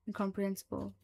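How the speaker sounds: ordinary delivery, nothing marked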